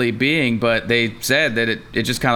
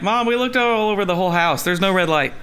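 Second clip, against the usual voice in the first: put-on whiny kid; 6 semitones higher than usual. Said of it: "being like".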